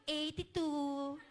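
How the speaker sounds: slow, drawn-out light imitation of Pia; a hint of Pia stretching the number; imitating Pia's voice. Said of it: "alam mo yung boses ng". (marahang ginagaya ang boses ni Pia)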